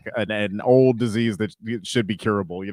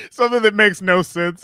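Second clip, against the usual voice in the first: words through laughter; laughing; 9 semitones higher, 7 dB louder than usual. Real, not acted. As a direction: laugh while getting it out. Laughing at his own joke.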